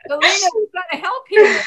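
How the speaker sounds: laughing loud plea. Real, not acted. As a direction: call for help through laughter. Pleading, laughing.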